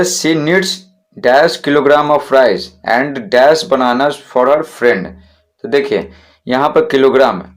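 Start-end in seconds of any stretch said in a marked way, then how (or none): 0.0-5.1 very slow, word by word, saying "dash" for the gap; slow dictation read. Shown as a demonstration. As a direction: read the sentence slowly for the students to follow. Teacher reads exercise sentence.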